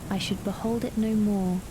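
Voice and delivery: ordinary delivery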